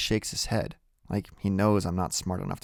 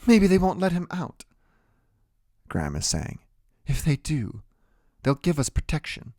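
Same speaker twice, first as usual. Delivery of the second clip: high, softening voice; grandmother pleading; 8 semitones higher than usual. A narrator's reading. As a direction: offer hope from up high. Grandmother, quarrel dying down.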